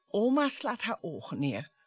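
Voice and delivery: ordinary talk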